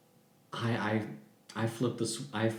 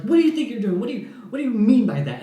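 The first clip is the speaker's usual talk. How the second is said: high, louder, fast indignant yelling; 10 semitones higher, 7 dB louder, faster than usual. Retold or imitated. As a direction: shout the questions right in his face. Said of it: "yelling at my face".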